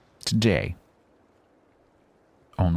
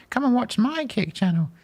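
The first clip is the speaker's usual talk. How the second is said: much higher, whiny sing-song; mocking spammer. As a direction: parody a channel spammer. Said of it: "I've had a few".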